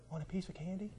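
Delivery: very quiet, hushed offer; soft trick offer. Retold or imitated. Father tricking young boys who won't listen, very soft. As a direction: say it very softly, as a lure. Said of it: "I would just softly say it".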